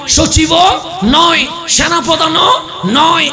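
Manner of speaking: loud, rising sermon cry over music; fervent waz preacher voice; preaching at full force. Acted. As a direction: build the crowd up, calling out each line. Preacher climaxes, loud and drawn out.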